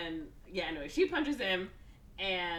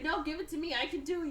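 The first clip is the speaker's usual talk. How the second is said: mock insistent; 9 semitones higher, faster than usual. Acted fight over the controls.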